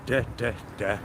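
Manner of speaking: normal talking voice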